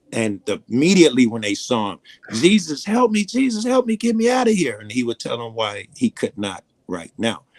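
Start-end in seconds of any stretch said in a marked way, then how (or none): 2.3-4.8 higher, begging cry for help; desperate pleading. Retold or imitated. Damned souls in hell beg Jesus, desperate pleading. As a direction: beg Jesus to save you.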